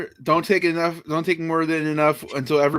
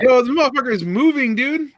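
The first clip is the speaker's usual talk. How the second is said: high, excited exclamation; pumped up watching him move; hyped up; 6 semitones higher than usual. Real, not acted. Hyped reacting to the performer.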